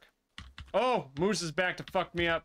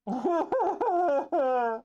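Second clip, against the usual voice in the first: loud pulsing laugh-like wail; cackle-wail in despair at lost progress; high, broken wail; 9 semitones higher, 5 dB louder than usual. Real, not acted.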